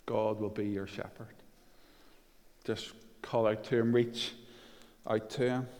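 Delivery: soft, low, unhurried assurance; gentle reassurance. Real, not acted. Preacher urging the lost to call out to God, reassuring.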